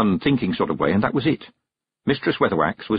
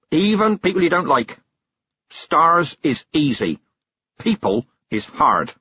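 put-on stern old witch; 5 semitones higher than usual. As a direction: drive the point home, crone. Weatherwax lecturing firmly.

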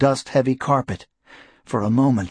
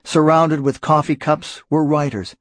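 ordinary delivery, nothing marked

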